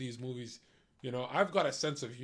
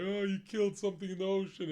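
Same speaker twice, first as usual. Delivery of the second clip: higher, mock-wounded whine; mock upset moviegoers; 5 semitones higher, faster than usual. Crowd crying over the shot seal, mock upset whine. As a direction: pout out the outrage in a hammed-up voice. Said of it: "They're like".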